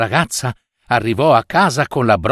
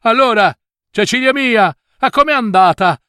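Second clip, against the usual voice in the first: high, eager shout to a daughter; calling out; 8 semitones higher than usual. Acted. Mother to returning Cecilia, calling out eagerly. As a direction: call from afar for news. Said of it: "gridò alla figlia".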